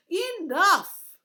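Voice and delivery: loud, high angry bark; commanded. A narrator's reading. Guard cuts off the chattering girl, angry shout. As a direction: cut her off hard. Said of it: "the thin guard commanded".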